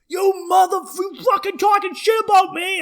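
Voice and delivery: high, loud outraged shout; angry dad yelling. Retold or imitated. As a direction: bellow like a furious dad out the window. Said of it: "my dad sticks his head out and goes".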